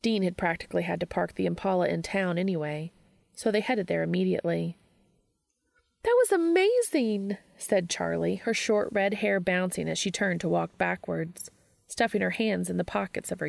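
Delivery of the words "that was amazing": high, loud, thrilled; gushing. Acted. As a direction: burst out with it, starstruck. Charlie gushing after the talk.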